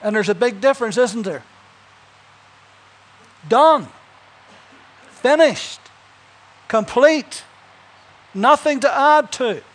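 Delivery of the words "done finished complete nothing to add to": loud, high, each word hammered; shouted pulpit declaration; emphatic proclamation. Real, not acted. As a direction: pound home every word. Preacher hammering the point home.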